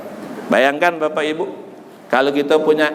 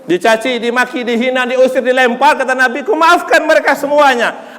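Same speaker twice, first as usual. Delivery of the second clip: raised, mounting sermon delivery; impassioned preaching; 5 semitones higher, 3 dB louder than usual. Real, not acted. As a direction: proclaim the Prophet's forgiveness with force. Preaches at full force. (khotbah lantang penuh semangat)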